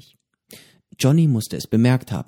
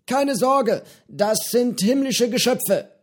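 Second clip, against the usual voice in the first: höhere, lockere Piratenstimme, freundlich plaudernd; Johnny, der alte Pirat, prahlt gemütlich mit seinem Fisch; gutmütiger Pirat Johnny; 12 semitones higher, slower than usual. A narrator's reading. Johnny beruhigt Petro. (höhere Stimme eines gutmütigen alten Piraten)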